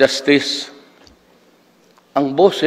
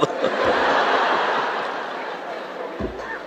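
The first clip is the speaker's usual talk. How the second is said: breathy laugh; 6 dB quieter than usual. Real, not acted. Laughing after the punchline.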